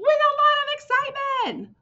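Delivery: high, bright excited telling; teacher showing excitement. Shown as a demonstration. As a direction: sound thrilled about it. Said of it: "Do you see how I'm really excited".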